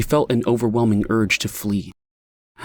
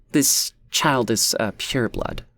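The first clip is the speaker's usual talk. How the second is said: smug; 2 semitones higher, slower than usual. A narrator's reading. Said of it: "her tone laced with smugness".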